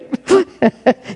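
high laughing burst; real laugh. Real, not acted. Reader cracks up at story.

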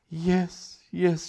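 high, moaning yes yes. A narrator's reading. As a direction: mengerang gemetar. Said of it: "she moaned, shuddering violently".

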